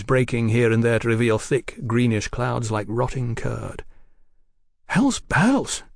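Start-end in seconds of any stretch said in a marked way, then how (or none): 4.9-5.8 high, thinly jolly exclamation; thin jollity. A narrator's reading. Young brother declaring in the ruined lot, thinly jolly. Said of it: "in a tone of thin jollity".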